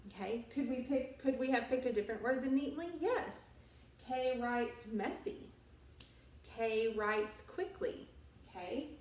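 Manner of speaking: usual speaking voice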